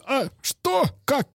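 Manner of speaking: sudden high-pitched shock; surprised. Pretend. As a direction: gasp it out, caught off guard. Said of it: "И ты такой".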